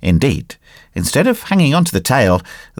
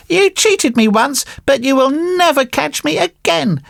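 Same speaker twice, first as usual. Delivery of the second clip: high-pitched puppet voice, brushing them off; dismissive marionette. Acted. Marionette rebuffs the false friends, high and dismissive.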